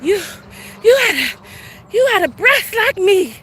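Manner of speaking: high, whiny mock imitation; mock breathless voice. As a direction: tease her as breathless and whiny. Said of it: "she like".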